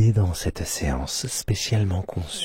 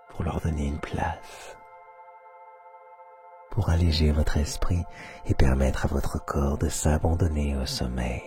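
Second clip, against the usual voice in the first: guided meditation; 3 semitones lower, 4 dB quieter than usual. Acted. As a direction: guide them into sleep, half whispering.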